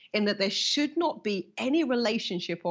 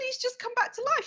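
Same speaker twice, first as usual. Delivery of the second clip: much higher, astonished report; breathless with wonder; amazed caller. Retold or imitated. Granddaughter telling the healer of a recovery, amazed.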